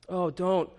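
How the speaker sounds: ordinary talk, nothing marked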